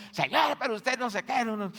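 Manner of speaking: high and loud indignant whine; mock grumbling complaint. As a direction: play the sister grumbling at God. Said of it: "salió renegando y diciendo". (reclamo renegando imitado, agudo y fuerte)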